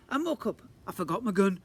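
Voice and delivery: high, loud bungling robber; silly robber. Acted. Robber bungles his hold-up, high and loud. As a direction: play the hapless robber.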